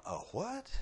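high, quiet puzzled question; puzzled listener. Pretend. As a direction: play the baffled listener, small high voice. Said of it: "for those of you who are going".

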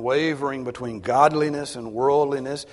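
usual speaking voice

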